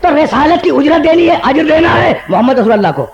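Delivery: rising preacher's cry; full-force preaching. Acted. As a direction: proclaim it to the whole gathering. Majlis sermon, peak of delivery.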